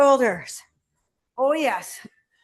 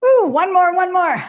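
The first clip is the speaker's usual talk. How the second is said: encouraging; 5 semitones higher, 6 dB louder than usual. Real, not acted. Trainer pushing the final rep.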